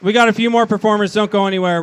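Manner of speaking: loud, fast crowd announcement; announcer shout. Real, not acted. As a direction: call it out to the room. MC addressing the crowd.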